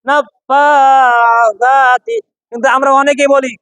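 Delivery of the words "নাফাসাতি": slow, stretched "নাফাসাতি" with a soft lisped th for the s; soft tongue-tip sound. Shown as a demonstration. Teacher models the correct recitation, soft th in "নাফাসাতি". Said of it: "সা জিহ্বার আগা থেকে নরম ভাবে".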